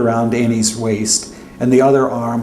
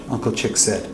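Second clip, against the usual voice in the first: plain reading voice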